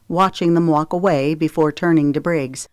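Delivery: usual speaking voice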